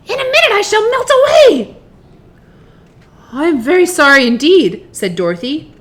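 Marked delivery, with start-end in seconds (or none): 0.0-1.8 very high, loud witch scream. A narrator's reading. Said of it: "she screamed". 3.3-4.9 loud, higher-pitched alarmed apology; frightened apology. A narrator's reading. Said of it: "who was truly frightened".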